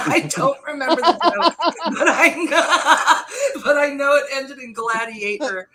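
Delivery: laughing. Real, not acted. Laughing at forgotten joke.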